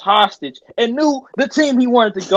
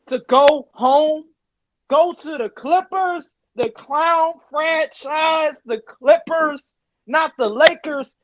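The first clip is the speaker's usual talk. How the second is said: higher and slow, nearly shouting; incredulous rant; 5 semitones higher than usual. Real, not acted. Ranting to the camera about Paul George, high incredulous near-shout. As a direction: spell out the insult like you can't believe it.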